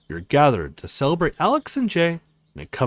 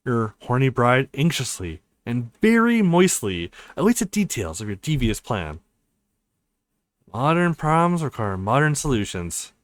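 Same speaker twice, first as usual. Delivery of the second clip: ordinary talk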